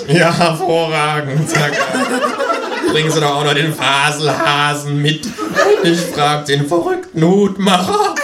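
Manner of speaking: high, laughing sarcastic doctor voice; mocking psychiatrist. A narrator's reading.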